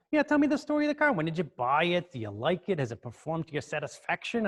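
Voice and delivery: rapid-fire New York questioning, starting high; New York accent. Retold or imitated. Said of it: "this kinda New York accent".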